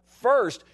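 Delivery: First! as a preacher's command; stress on First. Shown as a demonstration. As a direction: hit First hard and high. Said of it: "that's priority now".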